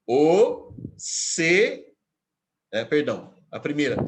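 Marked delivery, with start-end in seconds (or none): 0.0-1.9 "o … se", each syllable loud and separate; syllable scansion. Shown as a demonstration. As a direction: count out "o", then "se", with a gap. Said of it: "A segunda oração". ("o se" escandido, sílaba por sílaba)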